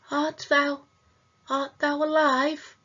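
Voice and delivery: put-on timid child ghost voice; hesitant ghost child. A narrator's reading. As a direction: ask as if afraid of the answer. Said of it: "hesitant and so faint".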